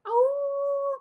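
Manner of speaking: high, loud playful howl; werewolf howl. Shown as a demonstration. Host showing children a werewolf howl, playful. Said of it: "Can we all go, ow?".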